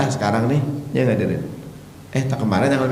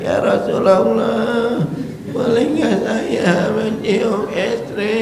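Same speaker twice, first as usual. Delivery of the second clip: put-on high, slow creaky old man voice; high, slow old grandpa plea; old man asking; 9 semitones higher, slower than usual. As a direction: play a trembling grandpa asking the Prophet. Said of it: "Kakek-kakek".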